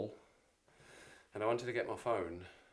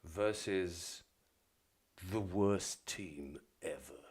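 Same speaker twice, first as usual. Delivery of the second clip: lightly put-on football results reader, lower; fixtures announcer voice; 3 semitones lower, slower than usual. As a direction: read the fixtures like a TV announcer, a touch lower and drawn out. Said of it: "Back into character".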